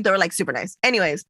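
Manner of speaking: ordinary talk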